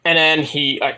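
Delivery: ordinary talk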